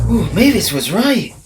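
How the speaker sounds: a nervous little engine; nervously. Acted. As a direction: let the worry creep in. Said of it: "he said nervously".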